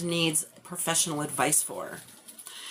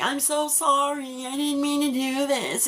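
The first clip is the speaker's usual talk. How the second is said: higher, pleading sorry from a weeping roommate; tearful apology; 6 semitones higher than usual. Retold or imitated. Roommate on the couch apologizing through tears, mock tearful. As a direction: imitate the crying roommate begging forgiveness.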